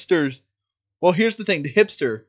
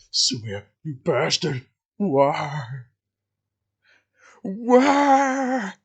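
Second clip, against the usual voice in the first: hammed-up betrayed victim; much slower than usual. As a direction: act out an agonized betrayed man. Acting out the betrayed character's dying words.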